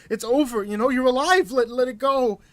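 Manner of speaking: desperate plea to let it go; begging. Acted. Hostage begging the narrator to let it go, building plea. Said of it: "He begged".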